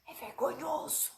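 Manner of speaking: hushed, confiding undertone; speaking low. Pretend. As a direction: drop the voice to share a secret. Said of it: "Mas eu vou falar baixo".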